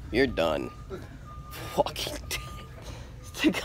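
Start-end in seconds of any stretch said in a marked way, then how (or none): 1.8-3.6 slow, breathy teasing whisper of the title; mock whisper. Teasing his friend about the figure, in a slow whisper. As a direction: whisper it slowly to needle your friend.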